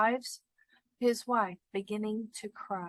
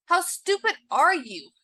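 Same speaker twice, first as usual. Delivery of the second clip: raised, louder exclamation; exclaiming; 6 semitones higher, 7 dB louder than usual. A narrator's reading. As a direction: burst out as the exasperated husband. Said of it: "exclaimed her husband".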